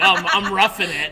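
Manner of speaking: loud, laughing brag; laughing mock pride. Childhood self on farmland, loud and laughing. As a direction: laugh out the boast. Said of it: "I'm like".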